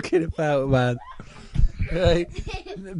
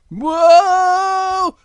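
long, high, loud whoa; falling cry; 9 dB louder than usual. Acted. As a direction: cry out as if tumbling from a window. Little Bear falls out of window.

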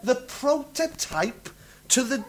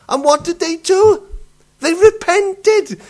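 higher and louder, slowing on They repented; building excitement; 8 semitones higher, 7 dB louder than usual. Acted. Preacher builds to revelation.

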